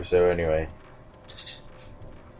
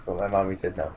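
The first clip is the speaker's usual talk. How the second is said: usual speaking voice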